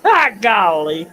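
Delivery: loud, slow, high startled cry; mock startled. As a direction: jump like you've been spooked. Mock startled after warning.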